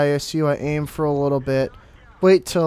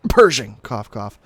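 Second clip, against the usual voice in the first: fake cough; 2 semitones lower, 7 dB louder, slower than usual. Joke fake cough naming tank.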